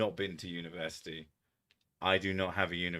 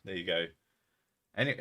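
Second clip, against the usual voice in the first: normal talking voice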